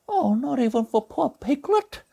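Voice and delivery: gentle cartoon bear narrating; Pooh Bear voice. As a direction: read it as a gentle honey-loving bear.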